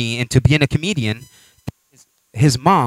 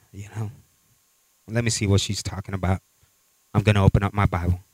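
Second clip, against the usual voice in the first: much softer and lower, resigned; calmed down; 4 semitones lower, 7 dB quieter, faster than usual. Retold or imitated. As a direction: let the anger drain out and speak quietly. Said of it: "then he calms down".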